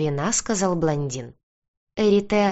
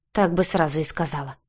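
usual speaking voice

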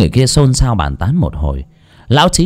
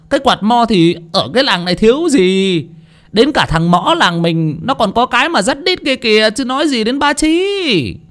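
mocking laughter; 10 semitones higher than usual. A narrator's reading. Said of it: "cười rộ lên".

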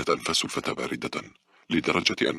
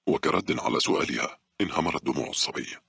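ordinary delivery

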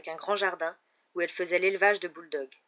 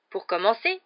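louder, higher grumpy opening; growled; 4 semitones higher, 7 dB louder than usual. A narrator's reading. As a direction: lay down the law crossly. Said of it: "grogna celui-ci".

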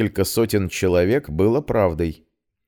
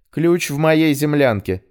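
plain reading voice

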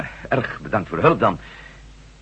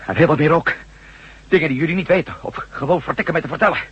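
heated insistence; 5 semitones higher, 6 dB louder than usual. Acted. Insisting about his past.

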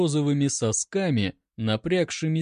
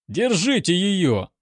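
raised, acted yell; 6 semitones higher, 4 dB louder than usual. A narrator's reading. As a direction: bawl out an order. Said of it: "орал один".

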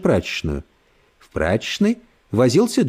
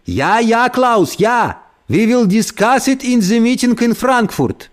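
shouting into phone; 9 semitones higher, slower than usual. Acted. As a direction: yell it into the phone. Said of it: "Кент кричал в телефон".

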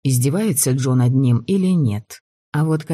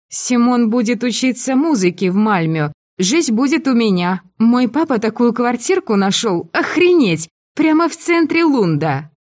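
высоко и громко, заплетаясь; как захмелевшая восторженная девушка; пьяная девушка; 9 semitones higher, 3 dB louder than usual. A narrator's reading. Пьяная Мари радостно делится. (пьяная Мари хвастается квартирой, высоко и громко)

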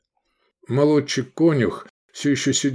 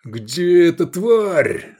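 angry roar, raised high and drawn out; growling; 8 semitones higher, 5 dB louder, much slower than usual. A narrator's reading. Lobanov hunting for the owner, angry growling roar. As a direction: roar it out slowly, hunting someone down. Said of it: "зарычал Лобанов".

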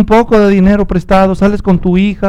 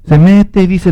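ordinary delivery

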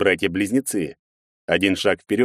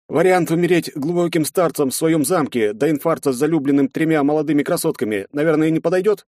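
wry higher hopeful ask; ironic; 7 semitones higher than usual. Acted. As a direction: ask it with dark irony. Hero's ironic question.

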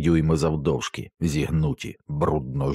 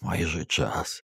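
slow, soft, fading murmur; dry, brittle mumble; 6 dB quieter, slower than usual. A narrator's reading. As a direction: let the words trail out, dry and frail. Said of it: "пробурмотів Дерек сухим, крихким голосом".

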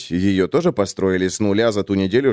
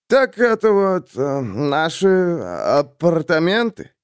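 slow, slurred and high; town drunk; 6 semitones higher, slower than usual. A narrator's reading. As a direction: drawl it like a tipsy man. Drunk Leo speaking. (пьяный голос)

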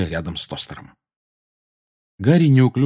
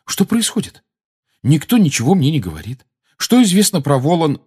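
high, loud, urgent questioning; insistent demand; 9 semitones higher, 5 dB louder than usual. A narrator's reading. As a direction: press them for answers, voice raised and high. Harry demands answers from the adults.

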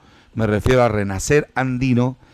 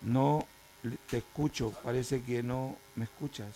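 distant, much softer aside; off mic; 19 dB quieter than usual. Real, not acted. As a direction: talk away from the microphone. Off-mic aside during call trouble.

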